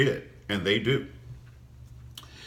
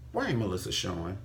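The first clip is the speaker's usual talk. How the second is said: normal talking voice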